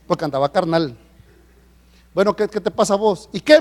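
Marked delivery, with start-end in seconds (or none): 2.1-3.6 higher, louder mock argument; acted challenge. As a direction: square up to the other driver, hammed-up. Acted road quarrel, exchange of challenges. (discusión airada, actuada)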